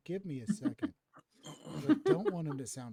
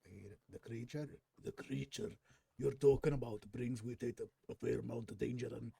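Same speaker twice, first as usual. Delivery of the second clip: put-on Irish accent.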